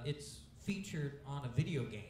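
usual speaking voice

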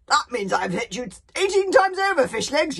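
loud, high, gleeful shout; joyful yell. A narrator's reading. Gobble the Belch yells joyfully at Fishlegs, loud and gleeful. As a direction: yell it out with glee at the target. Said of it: "yelled Gobble the Belch joyfully".

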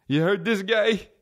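high, drawn-out goofy caricature of a friend; music buddy impression. Comic imitating music-obsessed buddy to the listeners, goofy high voice. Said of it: "That's how he sounds in my head".

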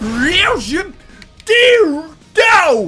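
dramatic voiceover. Retold or imitated.

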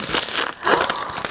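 sharp breathless gasp of delight; excited gasp. Real, not acted. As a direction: gasp at the gift. Opening gift package.

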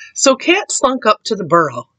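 usual speaking voice

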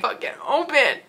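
laughing at a stuck door; laughing frustration. Real, not acted. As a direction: giggle through the exasperation.

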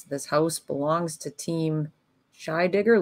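ordinary talk, nothing marked

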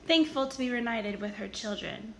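ordinary delivery, nothing marked

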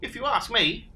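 squeaky eager advice; Platypus voice. Acted. Platypus offers advice to Dingo, high and eager.

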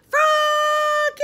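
high, loud, long-held call. A narrator's reading. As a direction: holler the name across the house. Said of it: "called his father".

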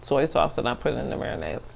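talking while chewing; chewy muffled speech; mouth full of salmon. Real, not acted. Seen 2: Salmon pieces put in mouth at +1.1 and +7.5; talks while chewing.

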